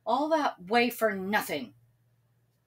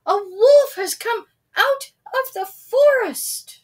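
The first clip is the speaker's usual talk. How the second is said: high, loud alarm cry; shouting; 14 semitones higher, 10 dB louder than usual. A narrator's reading. Sam yelling a false alarm to the villagers. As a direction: raise the alarm at the top of your voice. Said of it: "he shouted".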